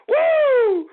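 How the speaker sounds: loud, very high "woo"; held falsetto holler; high whoop. Real, not acted. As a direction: let out a long piercing whoop. Whoops after cackling.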